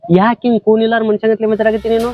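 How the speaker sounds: exasperated pleading. Acted. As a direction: plead for time, rising into reproach. Pleads and protests in debt quarrel. (ಬೇಸರದ ಮನವಿ)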